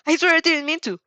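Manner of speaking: loud, high panicked pleading; frantic shouted plea; panicked yell. Acted. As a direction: yell it like you're about to be blown up. Mineta panicking, Bakugo yelling.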